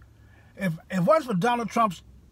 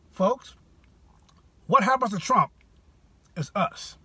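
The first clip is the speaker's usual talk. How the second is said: ordinary talk, nothing marked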